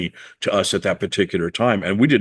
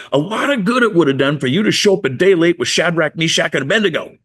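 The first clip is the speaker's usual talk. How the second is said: indignant retort; 7 semitones higher than usual. Retold or imitated. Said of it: "I said".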